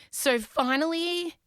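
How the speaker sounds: ordinary talk, nothing marked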